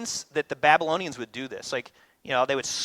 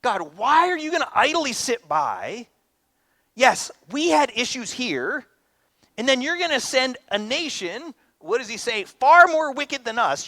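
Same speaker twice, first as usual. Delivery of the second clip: high, loud pleading complaint to God; pleading lament; 7 semitones higher, 3 dB louder than usual. Acted. Habakkuk crying out to God, high pleading complaint. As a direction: protest like a wronged prophet.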